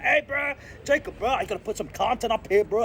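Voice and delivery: high, put-on voice of a hyped fan; imitating fan. Homie from Chicago hyping Jacob up, high mock voice. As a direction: mimic an eager homie calling out. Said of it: "and they're like".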